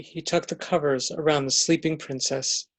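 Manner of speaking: ordinary delivery, nothing marked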